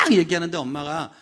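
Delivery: ordinary talk, nothing marked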